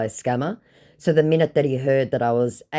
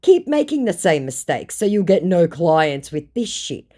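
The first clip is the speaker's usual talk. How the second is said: scolding; 6 semitones higher, 4 dB louder than usual. Retold or imitated. Scolding scammer.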